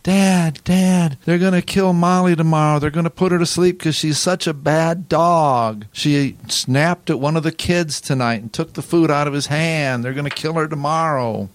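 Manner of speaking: put-on a frantic girl begging her dad over the phone; upset daughter on the phone. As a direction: daughter calling her dad in tears, begging to save the dog. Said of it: "she was just all upset".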